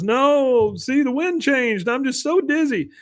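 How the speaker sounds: very high, drawn-out mock whine; Bill. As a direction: wail it like a helpless, dizzy man. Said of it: "you just hear this crying".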